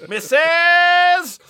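high loud Missus; hammed-up cheeky shout; cheeky call. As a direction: call out like a music hall comic. Calling out cheeky.

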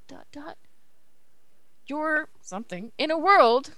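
usual speaking voice

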